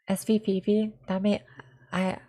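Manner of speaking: normal talking voice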